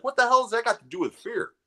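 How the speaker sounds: high-pitched incredulous complaint; mock disbelief. Viewer griping about Fear Factor's worm eating, incredulous. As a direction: protest how little sense it makes, voice climbing high.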